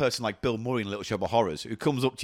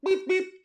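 high squeaky beep beep; squeaky horn noise; mock car horn. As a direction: honk like a little car.

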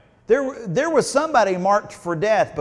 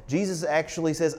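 ordinary talk

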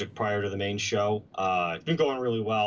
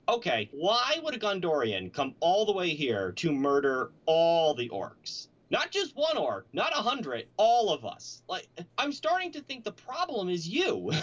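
higher, hesitant comic orc voice, lightly put on; a put-on orc whose confidence keeps slipping, pitched up; Shaka's unsure orc voice; 7 semitones higher than usual. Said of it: "he literally sounds like this".

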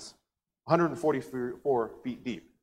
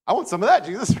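much higher, louder mock eagerness; eager woman; 10 semitones higher, 10 dB louder than usual. Samaritan woman begging Jesus for living water, hammed-up eager and loud. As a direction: grab at the offer like a kid at candy. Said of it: "she goes".

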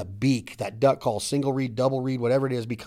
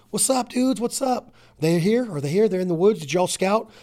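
high, eager buddy voice; buddy arriving at duck camp, pumped and high; excited camp greeting; 6 semitones higher than usual. Acted. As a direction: greet the crew like you just rolled into camp. Acting buddies arriving at camp.

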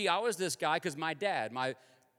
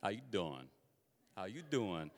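low-pitched, softer New York greeting; New York accent; 8 semitones lower, 6 dB quieter than usual. Shown as a demonstration. Preacher demonstrating how New Yorkers talk, New York accent. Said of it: "a New York accent".

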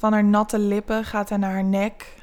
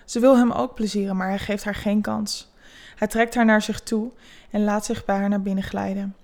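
ordinary delivery, nothing marked

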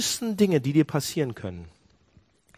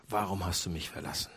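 much softer, lower forsaken plea; hushed plea; 7 semitones lower, 10 dB quieter than usual. Acted. Preacher voicing Jesus' last cry, hushed and low. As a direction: let the question fall away to almost nothing.

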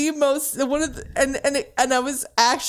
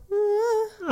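blank grunt; 10 semitones higher than usual. Retold or imitated. Vacant girlfriend grunting at dinner.

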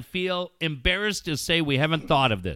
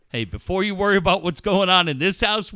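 ordinary talk

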